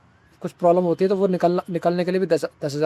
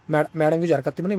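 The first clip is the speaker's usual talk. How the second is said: ordinary talk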